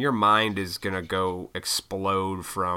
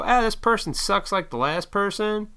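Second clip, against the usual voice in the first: mock whiny voice; 11 semitones higher than usual. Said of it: "You go".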